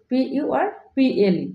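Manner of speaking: ordinary delivery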